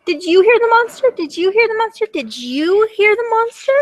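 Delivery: high, urgent, repeated questions; high-pitched storybook alarm; alarmed little dragon. Acted. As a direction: fire the question at everyone in play panic. Dragon asks everyone in alarm.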